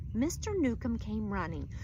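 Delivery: ordinary delivery, nothing marked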